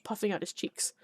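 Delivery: usual speaking voice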